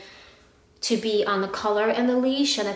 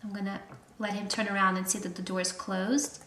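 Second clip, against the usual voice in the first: ordinary talk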